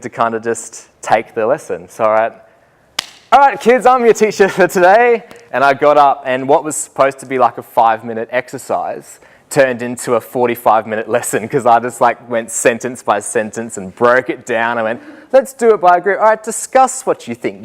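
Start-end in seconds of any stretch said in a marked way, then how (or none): 3.3-5.2 high, loud upbeat teacher announcement; teacher calling out. Retold or imitated. Addresses class as teacher. 15.3-17.6 teacher instructing. Retold or imitated. Instructing the class.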